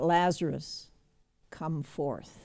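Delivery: ordinary talk, nothing marked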